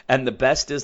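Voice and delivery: normal talking voice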